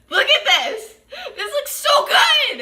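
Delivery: high laughing delight; excited squeal. Real, not acted. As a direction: squeal with joy at it. Delighted at result.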